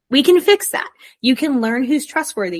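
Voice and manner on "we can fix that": higher, louder, stress on "can"; emphatic. Real, not acted. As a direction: push it like a promise. Repeats reassurance more emphatically.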